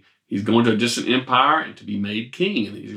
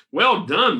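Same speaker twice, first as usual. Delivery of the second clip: high, bright praise; exclaiming king; 8 semitones higher than usual. A narrator's reading. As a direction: exclaim it with delight. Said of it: "the king exclaimed".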